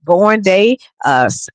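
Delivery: usual speaking voice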